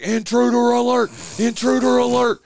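high, loud mock alarm chant; a man imitating his home alarm going off, high and loud. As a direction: blare it like a robotic house alarm. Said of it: "It's like".

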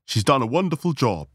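high, swooping approval; gushing praise. Acted. Ladies praise the hostess, gushing. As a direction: lift high on "wonderful" and let it fall.

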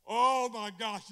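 drawn-out, booming "oh my gosh"; loud exclamation. Real, not acted. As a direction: cry it out loud and slow. Preacher bursts out mid-sermon.

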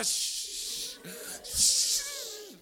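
long hissed shush; urgent shushing. Retold or imitated. Dad silencing Kellen in front of Mommy, urgent shush. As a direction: shush him in two drawn-out bursts.

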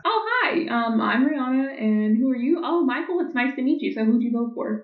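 high, sugary date small talk; girl on a date asking how he voted, high and sweet; mock first date. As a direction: play a sweet first-date voice, pitched well up.

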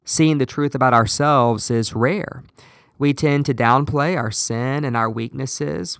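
usual speaking voice

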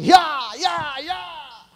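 shouted straining cries, acted; tirón del elefante imitado. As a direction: cry out as if heaving on a chain. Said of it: "el elefante no hace". (gritos de esfuerzo imitando al elefante)

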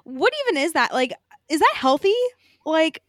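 high, loud, baffled questioning; incredulous. Real, not acted. Co-host questioning what the picture shows, incredulous.

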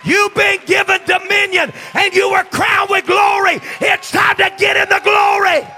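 loud, climbing sermon cadence, every phrase hammered; full-force preaching. Acted. Pastor drives the sermon home to the church, full-force preaching.

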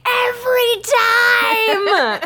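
high, loud, drawn-out scolding yell; furious yell. Retold or imitated. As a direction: blast it right into the dog's face. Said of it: "get in his face and I say".

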